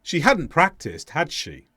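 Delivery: "had" stressed, "she" falling away. Shown as a demonstration. Teacher tests learners with an obvious-answer tag, "had" stressed, falling. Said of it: "the stress is on the auxiliary verb".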